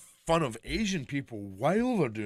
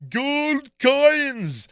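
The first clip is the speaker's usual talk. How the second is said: loud high Scottish Scrooge voice, shouted; Scottish accent; 12 semitones higher, 12 dB louder than usual. Retold or imitated. Scrooge McDuck shouting about gold in a Scottish accent. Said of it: "this guy goes".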